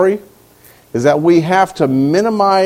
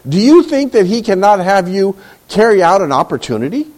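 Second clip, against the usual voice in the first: ordinary delivery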